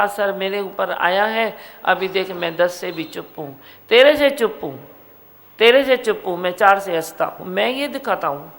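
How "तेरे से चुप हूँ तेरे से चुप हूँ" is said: higher, louder, hammered line; loud defiant declaration. Acted. Guru playing the calm one toward the offender, loud defiance. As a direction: fling it at him, proud and loud.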